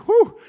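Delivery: sudden high, loud "whoo"; mock whoop. Exclamation amid mimed praying.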